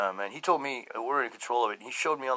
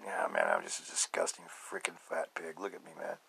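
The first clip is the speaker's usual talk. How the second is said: disgusted self-talk; 8 semitones lower, 11 dB quieter than usual. Retold or imitated. Voicing depressed self-talk.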